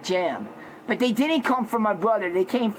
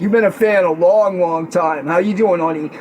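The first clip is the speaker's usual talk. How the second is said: lower, louder, gruffer-timbred guy voice; Jack persona; 5 semitones lower, 7 dB louder than usual. Acted. The Jack personality greeting a longtime fan in a lower voice. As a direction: drop down into a tough guy greeting fans. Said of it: "with me, Jack".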